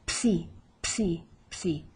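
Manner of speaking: "Psi" with the P sounded right into the S; P-S-I together. Shown as a demonstration. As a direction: pop the P in "Psi", then see. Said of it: "We say the P, the S, and the I all together".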